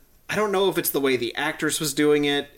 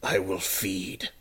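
menacing villain; 6 semitones lower, 6 dB quieter, much slower than usual. Pretend. Trying menacing villain lines.

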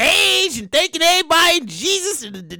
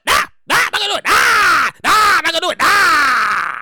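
louder, higher, stretched-out nahs; emphatic refusal; 4 semitones higher, 4 dB louder than usual. Acted. Playing famous self refusing the gala, hollered refusal. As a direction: holler the refusal, dragging each nah.

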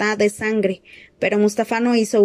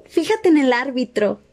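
laughing; 5 semitones higher than usual. A narrator's reading. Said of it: "le dijo riéndose".

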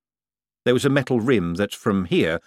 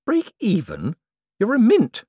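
incredulous echo; 12 semitones higher, 4 dB louder than usual. Acted.

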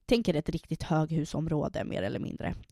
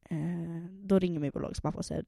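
normal talking voice

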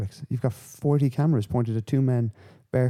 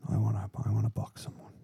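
mock whisper; 8 dB quieter than usual. Mocking TikTok boxer challenge.

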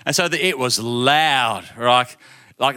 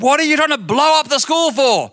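much higher, louder put-on principal voice; stern principal; 13 semitones higher, 4 dB louder than usual. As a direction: play the principal scolding the boy. Principal scolds the boy.

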